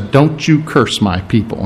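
low, quiet, grave command; a hushed, firm warning from God; stern God. Retold or imitated. God forbids Balaam to curse his people, low and grave.

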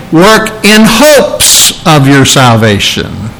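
HOPES stretched out slowly; slow phrase leaning long on "hopes"; single out "in hopes" as the wrong wording; stress on HOPES. Shown as a demonstration. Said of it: "He doesn't say".

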